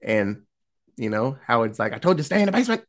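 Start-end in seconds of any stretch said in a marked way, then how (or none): 1.9-2.8 raised-pitch mock reprimand; mock scolding. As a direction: scold as an exasperated husband, lightly hammed up. Acted scolding of wife in retelling.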